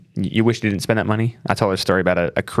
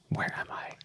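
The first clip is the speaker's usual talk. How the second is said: breathy whisper, almost no voice; whispered aside; 16 dB quieter than usual. Retold or imitated. Dad whispering his disbelief in the fancy store. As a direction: mutter it under the breath in disbelief. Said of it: "And I'm like".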